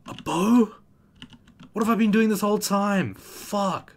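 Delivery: high, loud incredulous outburst; shocked disbelief. Real, not acted. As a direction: cry out in stunned disbelief. Realizes he misbuilt character.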